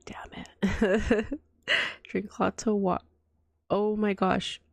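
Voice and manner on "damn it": retold whispered damn it; whispered curse. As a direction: breathe the curse out. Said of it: "I was like".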